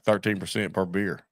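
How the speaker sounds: normal talking voice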